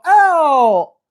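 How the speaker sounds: loud exaggerated ow. Shown as a demonstration. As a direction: let the dog know it hurt. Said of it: "but if I go".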